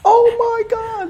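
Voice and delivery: hammed-up fangirl squeal over his guitar playing. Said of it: "I'll do my imitation".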